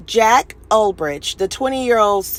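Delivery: normal talking voice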